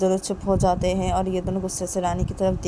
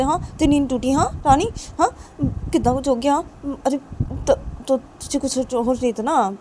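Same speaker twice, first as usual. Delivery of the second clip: higher, agitated character voice; Ravi, flustered scolding; 7 semitones higher, 4 dB louder than usual. Acted. Ravi scolds Rani for sleeping through it, flustered. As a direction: chide the sleeper in a fussing, raised pitch.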